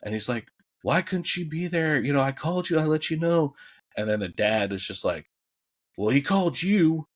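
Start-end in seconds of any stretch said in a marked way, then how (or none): 0.8-3.5 high, louder retold accusation; confronting his dad. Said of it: "he fucking confronts his dad". 5.9-7.1 dad snapping back. Retold or imitated. Dad answering son's confrontation.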